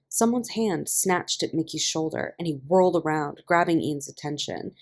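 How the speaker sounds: usual speaking voice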